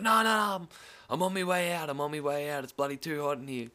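high, loud, frantic no no no; panicked to get out of the heat; flustered protest. Retold or imitated. Squeezed at the barrier, begging to leave, high and frantic. His own protest in crush, voiced.

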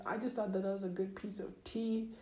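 ordinary delivery

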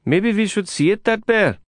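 high, gentle Indian English suggestion; Prabhakar suggesting. A narrator's reading. Prabhakar suggesting to Lin they visit, high and tentative. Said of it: "he suggested".

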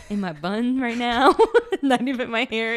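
words tumbling out through a laugh; laughing while talking. Real, not acted. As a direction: crack up at your own joke. Laughing at own [name] joke.

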